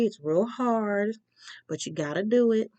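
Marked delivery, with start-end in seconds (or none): 0.0-1.2 drawn out. Real, not acted. Empathizing with a scary step.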